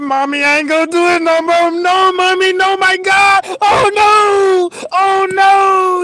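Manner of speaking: frantic high begging shout to his mama; pleading cry. Retold or imitated. Kid begging his mama not to whoop him, frantic crying shout.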